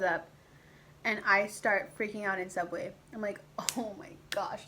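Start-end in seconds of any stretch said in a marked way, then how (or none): 3.8-4.6 hushed panicked whisper. Retold or imitated. Panicking in Subway.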